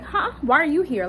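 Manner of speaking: high-pitched startled "huh?"; mock shock. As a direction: act taken aback, voice jumping up. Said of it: "kinda like a shock".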